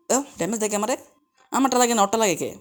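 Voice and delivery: normal talking voice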